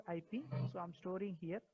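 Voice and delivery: faint, barely audible voice; speaking much too softly; very quiet. Real, not acted. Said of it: "[name], your voice is very low now".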